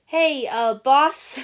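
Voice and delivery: high, loud hesitant call; nervous call. A narrator's reading. General calling nervously into the cafeteria, high and loud. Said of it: "he called".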